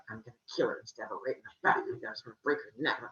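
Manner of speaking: low, fast plotting under the breath; mock menacing mutter. Female gods resent the hot girl, low muttered threats. Jealous goddesses plot against her.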